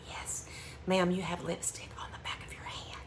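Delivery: retold clerk whispering; imitating a whispering shop clerk. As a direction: whisper it discreetly. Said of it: "She kinda whispered it".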